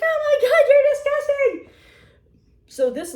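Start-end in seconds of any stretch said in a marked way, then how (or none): 0.0-1.8 very high, loud mock-disgusted squeal; hammed-up disgust, high and loud; grossed-out tease. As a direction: shriek it, teasingly repulsed. Coworker teasing through laughter.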